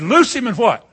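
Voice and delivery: Loose him called high and loud; ringing command. Shown as a demonstration. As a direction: order them to free him. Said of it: "He said".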